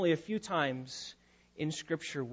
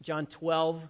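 normal talking voice

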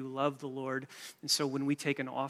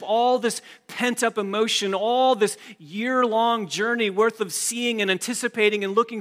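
loud, driving preacher's voice, climbing higher near the end; preaching at full force; 4 semitones higher, 9 dB louder than usual. Acted. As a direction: preach it to the back row, building. Preacher drives the point home.

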